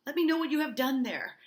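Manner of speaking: loud, high, insistent order; forceful demand. Acted. Uncle pressing niece for the truth, loud and forceful. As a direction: demand a confession as the angry uncle.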